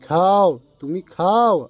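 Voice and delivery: loud emphatic খাও. Shown as a demonstration. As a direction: punch খাও to show the wrong meaning. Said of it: "তাহলে অর্থ হয় কি?".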